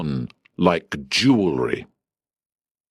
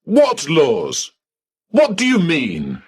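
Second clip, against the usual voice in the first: agitated high questions; 9 semitones higher than usual. Acted.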